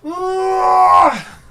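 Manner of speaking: long high loud scream of rage; frustrated scream. Real, not acted. At the failing text box, a high raging scream. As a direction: let out a raw yell of frustration.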